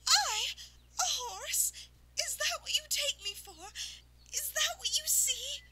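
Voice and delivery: high, loud, incredulous and offended; wounded pride, each question sharper; indignant outrage. Acted. Insulted unicorn confronting the farmer, high and loud.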